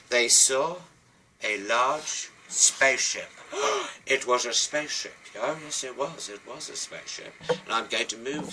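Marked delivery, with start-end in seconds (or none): 3.5-4.0 high, audible gasp; theatrical gasp. Acted. Storyteller revealing a large spaceship, amazed gasp.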